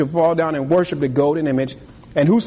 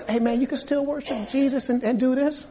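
high, casual hey man, lightly voiced; breezy invitation to compromise; party host; 9 semitones higher, 4 dB quieter than usual. Party guy inviting the preacher to chant, high and casual.

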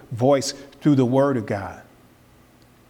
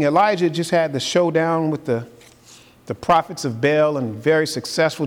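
normal talking voice

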